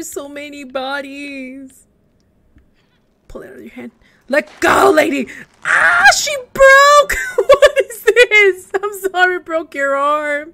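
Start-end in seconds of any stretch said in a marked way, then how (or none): 4.3-10.5 loud, high laughing outcry; laughing shock. Real, not acted. Player breaking a corpse's arm, loud laughing shock. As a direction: squeal and laugh at the broken arm.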